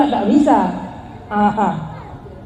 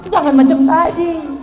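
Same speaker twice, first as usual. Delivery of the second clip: ordinary talk, nothing marked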